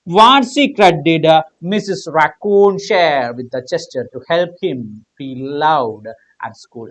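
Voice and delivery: slow, drawn-out reading of the question. Shown as a demonstration. Teacher reading the exam question slowly to students. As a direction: read each word slowly for the class.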